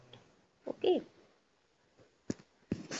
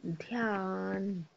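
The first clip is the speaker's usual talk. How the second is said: drawn-out syllable for dictation; slow teacher dictation; slow spelling; much slower than usual. Shown as a demonstration. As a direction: stretch the word slowly so kids can write it. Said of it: "बिंदी लगाना है".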